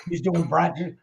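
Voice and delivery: usual speaking voice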